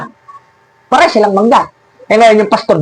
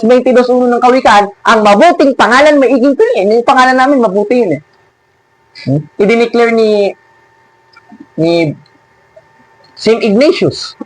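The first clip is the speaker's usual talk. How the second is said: usual speaking voice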